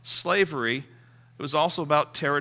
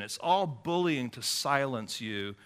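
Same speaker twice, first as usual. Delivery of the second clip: ordinary talk, nothing marked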